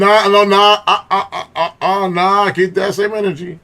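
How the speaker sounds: shouting nah over the clip, high and loud; host shouting at the apology clip; loud protest. Real, not acted. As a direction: yell it back at the screen in disbelief. Reacting loudly to apology video.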